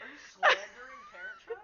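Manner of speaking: squealing with laughter, mock outraged; laughing. Real, not acted. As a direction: laugh through a playful accusation.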